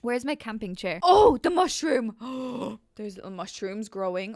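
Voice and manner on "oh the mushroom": delighted discovery. Real, not acted. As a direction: blurt it out on spotting it. Suddenly spots mushroom in game.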